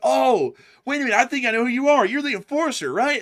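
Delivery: high, loud, fast eager gushing; excited fan. Retold or imitated. Thrilled fan recognizing the host, high fast excited gush. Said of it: "if they're excited to see you".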